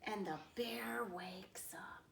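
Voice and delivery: slow suspenseful whisper. A narrator's reading. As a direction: hush it before the bear wakes.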